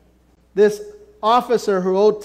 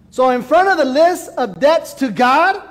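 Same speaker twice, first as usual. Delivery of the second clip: louder, higher sermon climax, near a shout; preaching at full force; 8 semitones higher, 3 dB louder than usual. Acted. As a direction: drive it home to the congregation.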